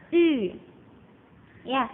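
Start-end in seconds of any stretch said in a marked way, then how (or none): 0.1-0.3 U pronounced "ee" through pushed-out, rounded lips; loud ü sound for U; Mandarin ü. Shown as a demonstration. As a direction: pout forward and say "ee" for U. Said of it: "tapi kita melafalkannya I".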